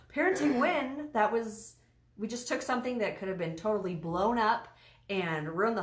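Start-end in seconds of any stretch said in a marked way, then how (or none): 0.1-1.1 mock triumph. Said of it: "I was like".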